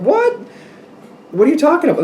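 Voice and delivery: high, incredulous what are you talking about; baffled what. Real, not acted. Reader baffled by the cards, high incredulous. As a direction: pitch right up in disbelief.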